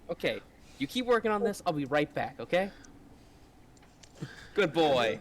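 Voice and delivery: talking to a pet. Acted. Talks to pet Gummy.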